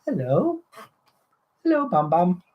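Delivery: high, sing-song hello; fond greeting. Real, not acted. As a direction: greet a little one in a lifted voice. Greets Amber, who just appeared.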